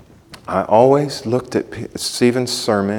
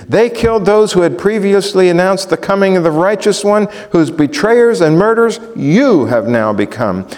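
preaching at full force; 5 semitones higher than usual. Acted. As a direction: proclaim the rebuke with rising force. Preacher reads Stephen's rebuke.

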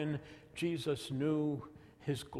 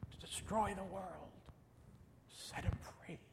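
very soft, higher, almost whispered; hushed; 5 semitones higher, 11 dB quieter than usual. Real, not acted. Preacher recalling a song about Jesus, hushed. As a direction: let it thin away to a reverent whisper. Preacher quotes a tender hymn.

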